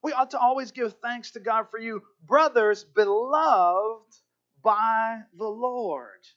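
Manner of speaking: loud verse read, slowing right down on brothers beloved; slow, swelling scripture proclamation. Acted. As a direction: linger on each word of the blessing.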